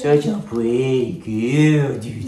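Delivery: slow tender praise; cooing. Real, not acted. Owner praising his dog slowly in a tender cooing voice.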